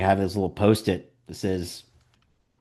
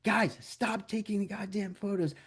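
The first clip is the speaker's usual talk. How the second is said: mock yell; 9 semitones higher than usual. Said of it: "would be screaming and cursing at these guys".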